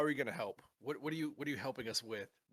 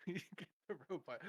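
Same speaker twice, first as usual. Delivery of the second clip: higher, fast, through a laugh; 7 semitones higher, faster than usual. Acted. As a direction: giggle it back quickly.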